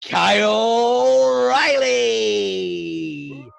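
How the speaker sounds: stretched-out announcer name call; boxing announcer introducing his guest, long drawn out; drawn-out hype intro. Acted. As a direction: draw the name out like a ring announcer.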